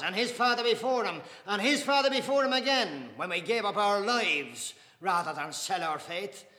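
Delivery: loud, high, swelling pride; proud defiance. Acted.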